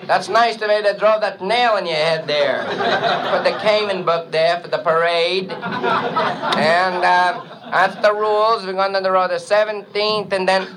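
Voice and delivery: put-on high, accented, chirpy bandleader voice. Bandleader interviews a hip trumpet player, high and chirpy. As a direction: play the square TV bandleader hiring a jazzman. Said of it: "we hear [name] interviewing the new trumpet player".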